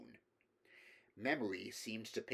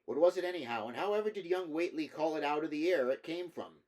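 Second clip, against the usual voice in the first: pressing villager; 6 semitones higher, 6 dB louder, faster than usual. Acted. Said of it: "to question the Arkham men anew".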